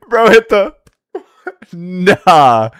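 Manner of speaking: cracking up mid sentence; laughing. Real, not acted. Laughing at clip.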